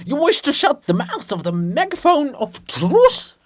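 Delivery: high, loud prophetic roar; booming proclamation. Acted. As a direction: thunder it like a megaphone. Bulgakov declaims, megaphone of truth.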